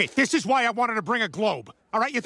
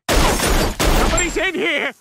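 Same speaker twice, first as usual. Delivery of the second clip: higher, louder, drawn-out yell; slow loud shout; 9 semitones higher, 9 dB louder, slower than usual. Acted. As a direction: bellow it slowly through the door.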